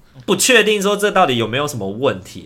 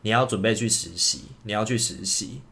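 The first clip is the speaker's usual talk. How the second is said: soft, fading murmur of a command; dreamlike inner voice; 7 dB quieter than usual. Retold or imitated. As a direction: let it sink quietly like a voice in a dream. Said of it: "我的大脑是告诉我说". (梦里轻声的指令)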